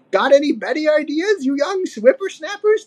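high-pitched crotchety codger; demanding old ape. A narrator's reading. Old ape to the youngsters, testy demand. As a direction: challenge them in a high old-timer voice. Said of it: "demanded the old ape".

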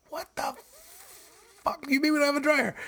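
high, fast exasperated disbelief; mock outraged yell. Husband retelling his fed-up reply to his wife on the phone, high incredulous outburst. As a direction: blurt it out, fed up.

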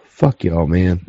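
very soft, sigh-laden and low; holding back tears, barely voiced; choked up. Real, not acted. Friend fighting tears while thanking his friends, soft and low.

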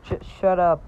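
understated stammered shout; mild shout. A narrator's reading. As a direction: blurt it with a slight lift in volume. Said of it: "shouted Marshall".

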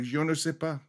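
softly spoken mock French reply; pretending French. Captured man posing as French to his captors, pretending French. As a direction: quietly play the Frenchman. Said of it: "kept on pretending French".

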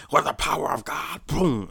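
high, loud hammed-up pastor shout; mock preacher. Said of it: "pretending it's a microphone".

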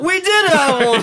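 high, slow mock clerk, laughing through it; imitating the clerk between laughs. Clerk returns with the rug.

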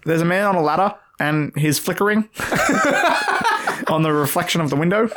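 high mock informer, breaking into laughter; giggly hammed-up busybody; put-on snitch voice. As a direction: tattle in a silly put-on accent. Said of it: "both putting on this accent".

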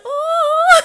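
very high, loud mock-tearful "oh"; retold overwhelmed squeal. Fan overwhelmed by wrestler's reaction, mock-tearful squeal. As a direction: squeal as if overcome, then laugh.

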